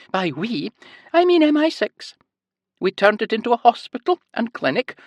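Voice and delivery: high sing-song Scottish lilt; cheerful high nurse explaining. A narrator's reading. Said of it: "a heavy Scottish accent and a high-pitched sing-song voice".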